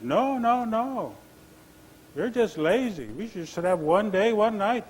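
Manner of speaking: high, insistent objection; objecting chipmunk. Acted. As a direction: argue back as a small, insistent animal. Chipmunk argues with Grizzly Bear.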